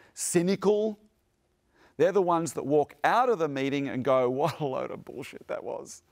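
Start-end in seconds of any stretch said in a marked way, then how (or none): 4.3-6.0 dismissive, offhand muttering, a touch quieter; scornful mutter. Retold or imitated. Protectors walking out of the meeting, muttered scorn. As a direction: sneer it as you walk out.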